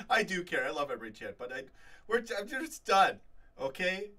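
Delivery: loud, fed-up fan blowing off steam; exasperated outburst. Real, not acted. A Liverpool fan venting to his chat, loudly exasperated.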